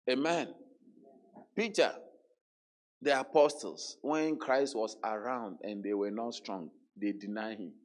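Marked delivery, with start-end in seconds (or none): none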